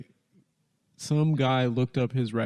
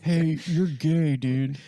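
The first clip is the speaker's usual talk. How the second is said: mock caller; 3 semitones higher, much slower than usual.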